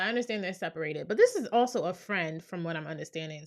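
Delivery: ordinary talk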